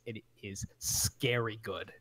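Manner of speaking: low, slow, hushed creepy delivery; creepy voice bit. Retold or imitated. Gamer telling friends, in a low slow creepy voice. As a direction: lean into scary good slowly and low. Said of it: "It's terrifying".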